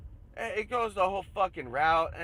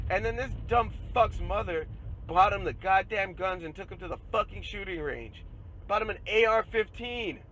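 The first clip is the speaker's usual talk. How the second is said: loud, higher, furious rant; angry rant; 5 semitones higher, 4 dB louder than usual. Real, not acted. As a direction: tear into them, voice climbing and loud. Furious rant about the shooter's mother.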